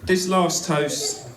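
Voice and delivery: loud, slow announcement. Real, not acted. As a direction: call the room to order. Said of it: "that would be my arse".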